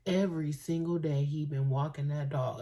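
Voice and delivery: ordinary delivery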